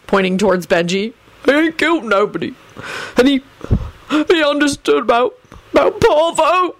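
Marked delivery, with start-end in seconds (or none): 1.5-6.8 high, halting, tearful wail; anguished blubbering. A narrator's reading. Bald man spares Benji, anguished and blubbering. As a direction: stumble through it, pitch climbing with grief. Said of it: "began blubbering so violently".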